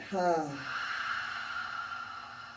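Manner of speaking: long, airy "ha" sigh; empty the breath out through an open mouth; open-mouthed exhale. Shown as a demonstration. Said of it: "Open your mouth and empty out".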